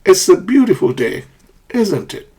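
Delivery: "isn't it" high, then falling; falling intonation. Shown as a demonstration. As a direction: state it surely, "isn't it" dropping. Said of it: "Falling intonation".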